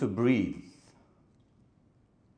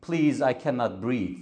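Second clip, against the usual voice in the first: mock begging; 4 semitones lower, 5 dB quieter than usual. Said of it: "and he is begging".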